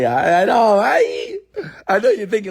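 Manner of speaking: high laughing squeal; giggling, almost crying with laughter. Real, not acted. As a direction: laugh through the words, voice cracking up high. Laughing at his own bit.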